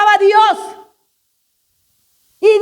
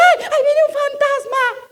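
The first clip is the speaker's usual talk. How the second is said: mock fright; 7 semitones higher than usual.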